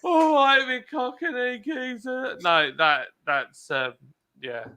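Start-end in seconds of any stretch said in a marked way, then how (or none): 0.0-2.4 a lightly hammed stage Cockney, drawn out.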